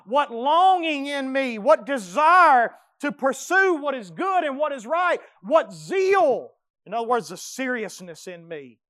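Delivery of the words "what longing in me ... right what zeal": loud, high ringing question; preaching shout. Real, not acted. Preacher rallies the church, shouting. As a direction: shout it to the congregation.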